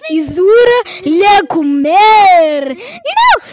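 wailing cry of terror; terrified scream. Acted. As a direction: scream as he grabs you. Screaming as killer attacks.

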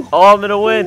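loud, high gloating cheer; a racing whoop of triumph; excited. Real, not acted. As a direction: crow that you are winning. Drag racing the mowers.

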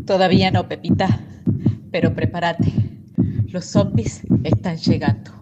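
a calm low warning of danger; grim mother. Acted. Mother to daughter as zombies come, low and grim. Mother hands her a shotgun.

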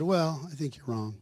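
low, soft, unruffled reply; understated calm disagreement. Retold or imitated. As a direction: retell it calmly and quietly. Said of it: "I just calmly said".

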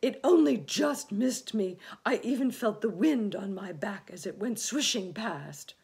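fearful loud lament, easing off; wailing. A narrator's reading. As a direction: wail about a near miss. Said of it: "wailed the earthworm".

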